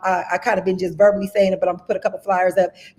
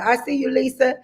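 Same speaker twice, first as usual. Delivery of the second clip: ordinary delivery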